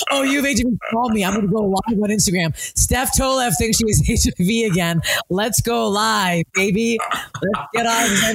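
high, hammed-up influencer energy; mock livestream hype. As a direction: hype up an imaginary live audience. Mock Instagram live hype.

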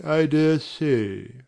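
slow, musing with stress on dare; slow doubtful repeat. Acted. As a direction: draw it out, unconvinced.

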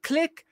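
mock microphone switch-on click; imitated mic click. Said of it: "turn on my little microphone".

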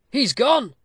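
loud, high cry of alarm. A narrator's reading. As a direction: cry out as disaster strikes. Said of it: "cried Peter".

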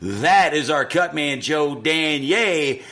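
booming slow ringmaster intro; loud, drawn-out boxing announcer call. Acted. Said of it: "these introductions, man, getting better and better".

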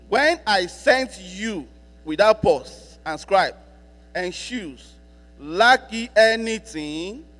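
slow, solemn Bible reading drawn out; scripture reading. Acted. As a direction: read each phrase slowly like holy text. Rereads the same verse slowly.

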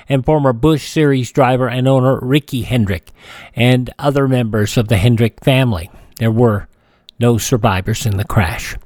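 usual speaking voice

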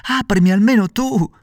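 high, loud begging shout; pleading cry. A narrator's reading. Pinocchio begging the girl to open, high loud plea. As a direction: cry out desperately to be let in. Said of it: "gridò Pinocchio piangendo e raccomandandosi".

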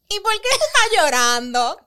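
mock husband asking why she's crying; husband's teasing question. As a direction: tease her for crying, voice lifted. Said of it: "se muere de la risa y me dice".

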